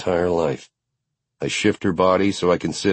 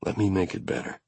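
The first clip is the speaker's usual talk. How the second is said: murmured close to her ear, very soft; 7 dB quieter, faster than usual. A narrator's reading. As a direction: hush her gently, barely voiced. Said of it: "I murmur in her ear".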